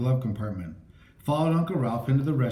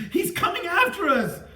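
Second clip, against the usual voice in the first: scream. A narrator's reading. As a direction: scream the warning in horror. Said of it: "Chris screamed".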